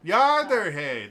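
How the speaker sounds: loud, high Yooper exclamation; hammed-up Upper Michigan Yooper; Yooper accent. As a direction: belt it out like a Yooper. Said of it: "that's what you say when you're like".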